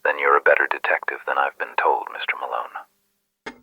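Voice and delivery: secretive whisper. Acted. Anonymous caller answering the detective's fear of a trap, cool whisper. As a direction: whisper it, dragging out the name.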